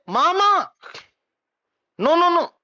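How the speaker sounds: high, emphatic mock protest; acted objection. Ajat Shatru stops Balaki short, in high protest. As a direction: cut in with a high, firm refusal.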